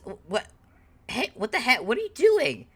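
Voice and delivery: high, incredulous questions climbing in pitch; a startled man catching a thief at it; startled indignation. Acted. Man confronts a pickpocket at his pocket, indignant.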